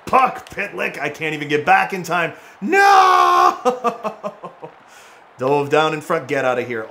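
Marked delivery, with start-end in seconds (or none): 2.6-4.3 high, loud cry of "no"; anguished shout. Real, not acted. Loses out during the game.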